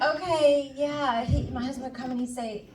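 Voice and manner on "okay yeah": passive aggressive. Retold or imitated. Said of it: "I'm kinda passive aggressive".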